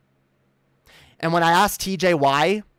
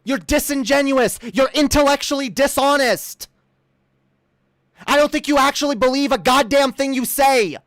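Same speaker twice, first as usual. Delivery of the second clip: high, forceful angry rant; heated accusation; 6 semitones higher, 3 dB louder than usual. Real, not acted. Streamer accuses opponent of bad faith, angry and high. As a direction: hammer each charge home indignantly.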